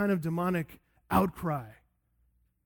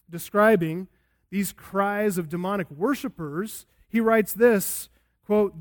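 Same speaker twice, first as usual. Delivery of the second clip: ordinary delivery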